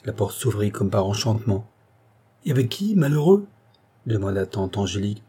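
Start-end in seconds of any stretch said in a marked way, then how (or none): 2.5-3.5 high, pressing outraged question; aghast aunt, rising to a loud last word; indignant demand. A narrator's reading. Aunt demanding whom her nephew will marry, indignant.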